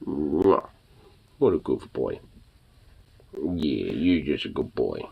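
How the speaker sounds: soft, slow, low cooing; slow pet talk. Real, not acted. Owner softly coos to her dog. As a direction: coo tenderly at the puppy.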